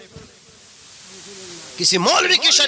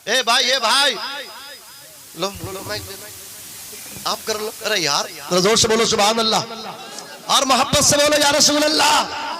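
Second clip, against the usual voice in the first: full-force preaching; 6 semitones higher than usual. Acted. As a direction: preach it out to the crowd at full pitch.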